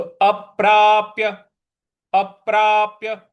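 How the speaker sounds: अप्राप्य with firm weight on both प; stress on प. Shown as a demonstration. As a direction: press the प sounds in अप्राप्य. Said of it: "इस प्रकार से बोलेंगे".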